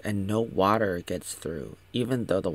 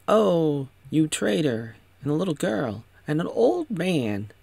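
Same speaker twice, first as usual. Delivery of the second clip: raised-pitch imitation of an indignant villain; mocking outrage; 7 semitones higher than usual. Reva accuses the traitor, high mocking outrage. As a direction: exclaim it high, mock accusing. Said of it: "she's like".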